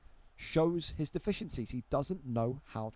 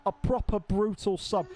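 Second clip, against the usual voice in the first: slow, louder mock northern English accent; 3 semitones higher, 5 dB louder than usual. Mocking his mate's line in a slow northern accent. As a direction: drag out a broad northern accent to mock a mate. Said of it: "that's the most northern shit I've ever heard".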